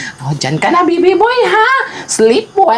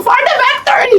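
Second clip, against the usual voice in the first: high-pitched "For the victory!"; playful battle cry; 4 semitones higher than usual. Shown as a demonstration. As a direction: chant it high for someone to copy. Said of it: "Gayahin mo yung boses ko". (mataas at masiglang sigaw)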